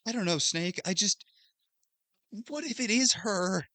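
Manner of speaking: a nervous, hopeful nerd asking what if. Retold or imitated. Otacon answering Snake in the cutscene, high and pleading. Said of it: "Otacon's like".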